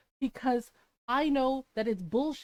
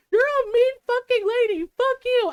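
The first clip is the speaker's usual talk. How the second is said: high, loud, hammed-up indignation; wounded mock yelling; mock angry outburst; 10 semitones higher, 7 dB louder than usual. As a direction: imitate an offended boyfriend lashing out. Retold boyfriend's angry outburst.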